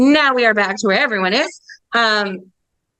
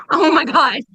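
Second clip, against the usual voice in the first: laughing exclamation; 6 semitones higher than usual. Real, not acted. Dogs barking, exasperated laugh.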